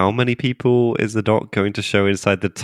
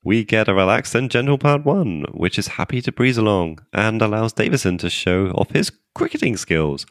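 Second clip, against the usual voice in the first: normal talking voice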